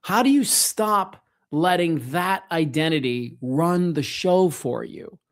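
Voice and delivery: ordinary talk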